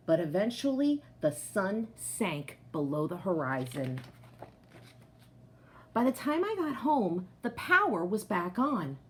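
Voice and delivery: ordinary delivery, nothing marked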